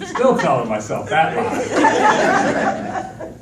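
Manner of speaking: slow, laughing punchline; slow, self-mocking laugh. Real, not acted. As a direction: drag it out while chuckling at yourself.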